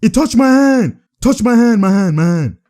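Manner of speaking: loud, insistent complaint on repeat; hollered plea to the referee; shouted protest. Acted. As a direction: yell it as an indignant player's appeal. Shouts a disputed call.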